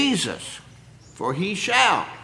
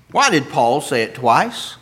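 normal talking voice

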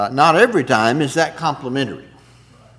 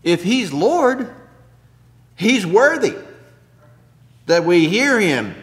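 fervent preaching; 5 semitones higher, 4 dB louder than usual. Real, not acted. Preaching climax, pitched high.

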